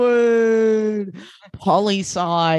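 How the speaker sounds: very slow, stretched vowels; drawn-out mock heartthrob; Hollywood voice. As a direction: echo it with hammed-up flourish. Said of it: "that voice for Nate was giving".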